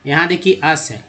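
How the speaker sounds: normal talking voice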